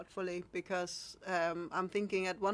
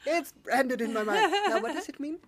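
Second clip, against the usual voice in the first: teasing; 7 semitones higher, 10 dB louder than usual. Retold or imitated. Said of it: "I'm like".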